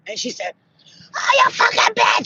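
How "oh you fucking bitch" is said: high, loud enraged shriek; acted outraged screech; furious witch. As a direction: scream the insult as a maddened witch. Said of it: "She got so fucking mad".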